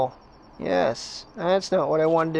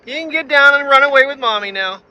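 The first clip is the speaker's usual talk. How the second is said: calling to bear cub; 5 semitones higher, 5 dB louder than usual. Real, not acted. Telling bear cub to leave.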